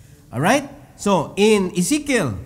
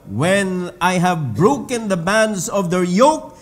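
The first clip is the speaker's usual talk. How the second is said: loud, slow scripture reading; preaching scripture; 2 semitones higher, 6 dB louder, much slower than usual. Acted. Preacher proclaiming the verse to the church, loud and slow. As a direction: proclaim the verse slowly to the hall.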